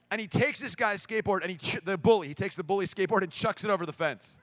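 normal talking voice

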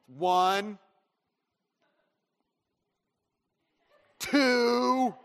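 slow one, then a high loud two; drawn-out count. Retold or imitated. Dad stretching the count to warn his son, slow and rising. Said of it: "the longest count of three in the world".

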